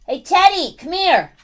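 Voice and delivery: high, loud pet-calling voice; calling the dog. Real, not acted. Owner calls Teddy in to say goodbye, calling out. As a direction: summon the dog from across the way.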